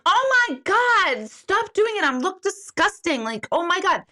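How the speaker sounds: mother squealing at her son to stop the photos, hammed-up horror; mock horrified shriek. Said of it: "and I'm like".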